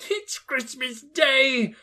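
high, slow wonder; Scrooge amazed. A narrator's reading. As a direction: savour the news. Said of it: "said Scrooge to himself".

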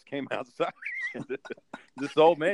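ordinary talk

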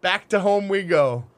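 high, loud, slow proclamation; mock heroic. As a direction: announce it like a pompous knight. Parody heroic march line.

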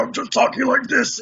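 rumbling monster voice from the throat. Shown as a demonstration. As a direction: talk through a rumble in your throat. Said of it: "what I call throat rumbling".

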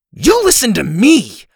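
loud, high furious demand; angry outburst. A narrator's reading. Girl demanding the boy's attention, angry and loud. Quarrel, grabs him by collar.

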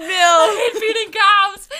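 high, loud and laughing, slow. Real, not acted. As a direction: blurt it out laughing. Laughing outburst.

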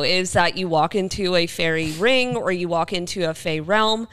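usual speaking voice

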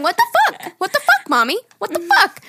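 very high mock child voice; high-pitched little kid imitation; parroting kid. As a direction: chirp it like a copycat toddler. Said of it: "The kid's just going".

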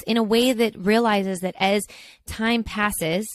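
ordinary talk

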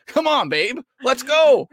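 high, loud eager plea; excited pleading. Retold or imitated. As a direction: cheer her on like a fan. Pleading with wife for logo.

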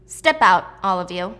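loud opening, firm order; acted command. Gunman orders hidden sisters out, acted firm command. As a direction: order them out, loud on the first words.

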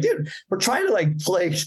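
high-pitched, exasperated mock complaint; retold protest. Musician to the producer shoving him, retold high protest. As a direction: plead to be left to play, imitating. Band protests at being shoved.

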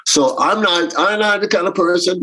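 ordinary delivery

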